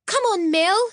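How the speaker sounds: calming down. Acted. Maud urging Mildred to land, still giddy. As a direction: pull yourself together. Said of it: "trying hard to calm down".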